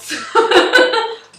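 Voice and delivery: loud, high laugh breaking out; high burst of laughter. Real, not acted. Vlogger laughing at her six inconsistent YouTube years, loud high laugh.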